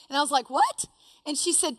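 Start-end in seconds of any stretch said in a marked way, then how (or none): 0.5-0.8 very high, rising mock yelp; startled. Woman first hearing about the hurricane, startled. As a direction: squeak it in disbelief.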